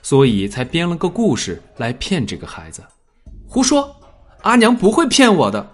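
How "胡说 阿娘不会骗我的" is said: higher and louder, sharp and defensive; sharp hostile retort. Acted. The boy angrily defends his mother against Zhu Yan, sharp. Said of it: "苏魔的声音忽然尖锐了起来，带着敌意".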